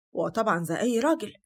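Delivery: usual speaking voice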